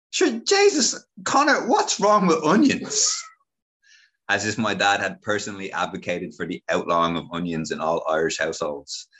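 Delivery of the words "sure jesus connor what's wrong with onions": much higher, louder shocked auntie; octave higher. Retold or imitated. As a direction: squeal it up high, outraged. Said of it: "My auntie's voice raised an octave or two".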